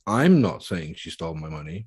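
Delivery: "I'm" stressed, the rest falling away. Shown as a demonstration. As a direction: lean hard on the first word "I'm". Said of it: "word you emphasize".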